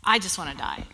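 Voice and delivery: whispered, hopeless repeat of the line; despairing whisper. Retold or imitated. Quoting a despairing person, the echo falls to a whisper. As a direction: let the words fall away to a whisper. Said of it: "so many people that say".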